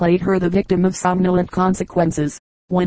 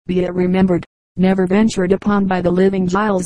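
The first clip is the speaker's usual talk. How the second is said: ordinary delivery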